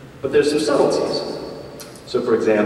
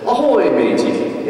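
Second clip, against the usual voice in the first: put-on slow pirate accent greeting, a little higher; best pirate voice; 7 semitones higher, slower than usual. Greeting his pirate friend in the bar, slow pirate accent. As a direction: growl out a pirate hello in a pirate accent. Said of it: "in my best pirate voice".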